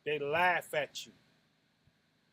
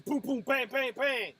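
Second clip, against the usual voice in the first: punch sounds; 5 semitones higher than usual. Retold or imitated. Miming punches landing.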